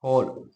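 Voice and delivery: हॉल with the open rounded ऑ, as in hall; example word. Shown as a demonstration. Teacher showing students the borrowed vowel, precisely. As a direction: say हॉल carefully, vowel between आ and औ. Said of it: "जैसे हम कहते हैं".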